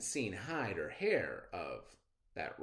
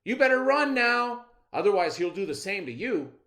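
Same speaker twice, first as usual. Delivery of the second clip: higher, louder urgent warning; trickster rabbit spinning a tale; 7 semitones higher, 8 dB louder than usual. Acted. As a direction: scare him off with a tall tale.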